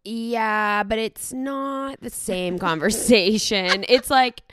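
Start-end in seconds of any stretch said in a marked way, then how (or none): none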